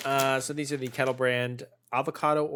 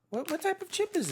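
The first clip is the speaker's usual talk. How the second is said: parrot voice; 3 dB quieter than usual. Pretend. Continuing high squawky voice.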